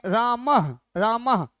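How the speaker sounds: रामः said as रामह with a breathy ending; visarga ending. Shown as a demonstration. Teacher demonstrates रामः to the class. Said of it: "विसर्ग लगा दिए तो क्या पढ़ेंगे".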